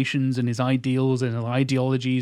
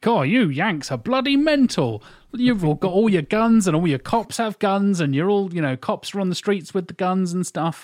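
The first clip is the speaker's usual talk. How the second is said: mock British rant; 6 semitones higher than usual. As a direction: scoff at the Yanks like an appalled Brit. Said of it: "the Brits going".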